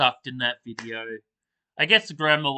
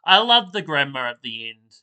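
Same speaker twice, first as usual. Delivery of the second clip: ordinary talk, nothing marked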